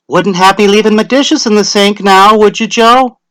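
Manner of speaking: high, loud grinning retort; grinning Tessie. A narrator's reading. Wife teases the official, high and grinning. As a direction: toss it back with a grin.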